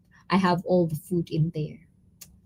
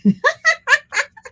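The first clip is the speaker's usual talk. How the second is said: high squeal laugh; 4 dB louder than usual. Real, not acted. Laugh at self.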